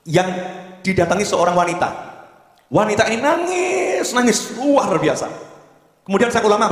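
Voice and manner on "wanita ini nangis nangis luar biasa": slow and much higher, stressing the crying; dramatic telling. A narrator's reading. Preacher telling the congregation of the weeping woman, slow and high. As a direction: draw out "nangis" high to paint her weeping.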